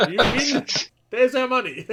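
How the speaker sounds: loud, high and gleeful; excited high shout. Retold or imitated. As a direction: shout it in delight. Retelling reaction, loud high.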